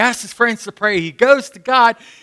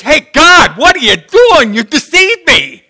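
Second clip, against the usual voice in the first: loud, higher accusing cry; Jeremiah venting; 8 semitones higher, 7 dB louder than usual. Retold or imitated. Jeremiah accusing God, loud and hurt. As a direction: throw it at God. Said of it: "Jeremiah says".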